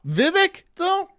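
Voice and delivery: high squeaky disbelieving question; mock disbelief. Real, not acted. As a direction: squeak it out in fake suspicion. Mock suspicion of a name.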